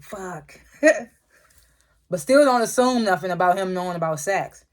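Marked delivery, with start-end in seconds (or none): 0.0-0.6 imitated muted curse. Sax as Davis walks into the room, muted curse. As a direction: say it under your breath, as if quoting.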